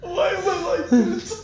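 very high, loud wail through laughter; helpless laughing. Real, not acted. Comedian appalled at own joke, laughing to tears. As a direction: squeal it out, nearly crying with laughter.

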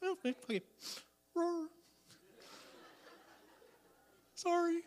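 high, meek little roar; timid backing down. Retold or imitated. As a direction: shrink away meekly. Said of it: "it's like".

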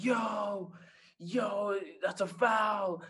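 high-pitched mock yell without full volume; imitated scream. Dad yelling at the TV during the game, imitated high scream. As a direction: act out an animated fan's high, drawn-out holler. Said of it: "He's screaming at the, at the, at the T V screen".

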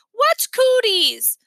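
put-on high, loud, drawn-out kid's question; insistent little boy. A narrator's reading. Little brother back in business, insistent question. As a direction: blurt it slowly and loudly, demanding an answer.

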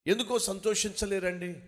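ordinary talk, nothing marked

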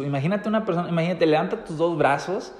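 ordinary talk, nothing marked

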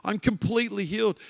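high, loud retold amazement; ecstatic. Preacher acts out the woman healed of cancer, ecstatic. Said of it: "She's, like, ecstatic".

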